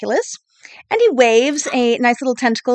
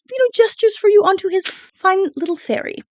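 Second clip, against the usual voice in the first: normal talking voice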